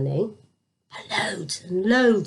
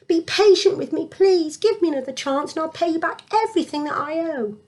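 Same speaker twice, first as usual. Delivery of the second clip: pleading; 7 semitones higher than usual. Acted. Second servant begging.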